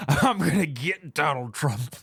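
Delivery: slow put-on caricature voice, stifling a laugh; Letitia James voice. Vlogger mocking the attorney general's pledge, slow put-on voice. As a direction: drag out the promise in a mock politician voice. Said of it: "I'm still doing the voice".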